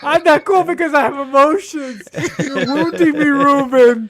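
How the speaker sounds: hammed-up wounded whine, high and loud; overacted heartbreak through laughter; mock hurt. Teased, mock wounded by Ruben.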